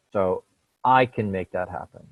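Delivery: I stressed. Shown as a demonstration. Reading the son's sentence, stressing I. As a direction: lean on the word I. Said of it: "with emphasis in three different places".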